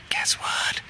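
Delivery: conspiratorial whisper; imitated whisper. Woman teasing filmmaker with a secret, mock-confidential whisper. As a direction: whisper it as bait before a shout. Mamie's secret before the shout.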